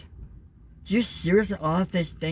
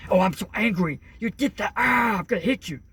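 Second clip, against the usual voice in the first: mock angry parent; 5 semitones higher than usual. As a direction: ham up a parent about to lash out. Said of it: "the type of person that's gonna be like".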